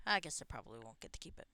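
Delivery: ordinary delivery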